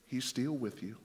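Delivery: low, hushed comfort; soft reassurance. Real, not acted. Preacher comforting the wounded child of God, softly reassuring.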